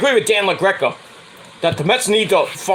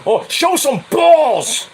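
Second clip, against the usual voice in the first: much higher, slow mocking radio host; imitating Dan LaGreca; 6 semitones higher, slower than usual. Mimicking the radio host scolding the Mets, high and slow. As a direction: drag it out like a sneering talk host. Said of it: "hearing Dan LaGreca say, he's like".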